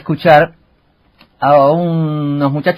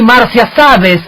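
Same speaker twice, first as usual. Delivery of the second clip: much higher, swooning soap-opera gush; dubbed telenovela voice; 12 semitones higher, 5 dB louder, slower than usual. Retold or imitated. Mocking Chilean soap dubbing, telling Marcia about him in a high swooning gush. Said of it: "como hacen los chilenos cuando traducen un teleteatro".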